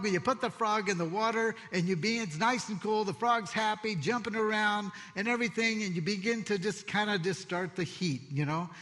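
ordinary talk, nothing marked

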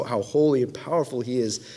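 normal talking voice

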